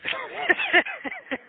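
sudden high laugh; real laugh. Real, not acted. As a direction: laugh out loud, delighted. Laughs at her supportive remark.

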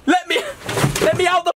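very high-pitched outraged yelling; frantic shouting. Acted. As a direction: yell desperately to be let out of the van. Phone broken, demands to be let out.

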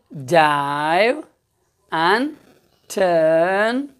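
drawn-out words with pauses between; slow quoting. Retold or imitated. Teacher reminds class of frog's words, slow spaced-out quoting. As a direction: stretch "Dive" and "turn", pausing around "and".

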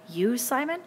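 high, rising disbelief; incredulous, pitch climbing to the end; amazed. A narrator's reading. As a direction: question him in astonishment. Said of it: "Ralph stood up in amazement".

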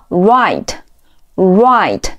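"write" said slowly like "rite"; silent W. Shown as a demonstration. Teacher giving similar words to learners, "write" with silent W. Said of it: "通常W不发音".

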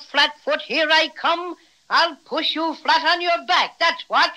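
ordinary delivery

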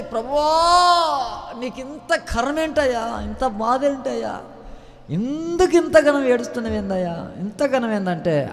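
high, slow keening plea; drawn-out lament, high and pleading; wailing crowd. Retold or imitated. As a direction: wail it out like mourners at the cross. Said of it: "అందరూ గొల్లున లేచి".

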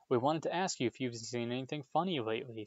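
ordinary delivery, nothing marked

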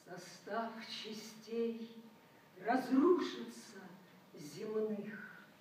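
prophesy the end of the world, then let it die away; solemn poetry recital. Acted. Reciter to the audience, slow, building, then fading.

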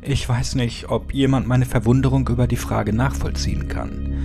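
usual speaking voice

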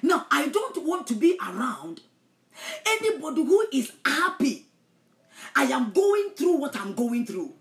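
full-force sermon delivery; fiery preaching. Acted. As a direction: thunder it at the congregation.

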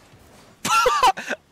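high burst of laughter. Real, not acted. Cars flying overhead.

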